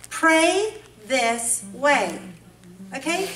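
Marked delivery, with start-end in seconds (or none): 0.1-2.2 very slow, weighty; each word laid down slowly; solemn command. Retold or imitated. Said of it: "Jesus Christ himself says".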